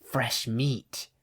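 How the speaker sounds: soft, hissing creep voice; quiet sinister hiss; creepy hiss. A narrator's reading. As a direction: hiss it like something hungry. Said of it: "hissed a creepy-looking man".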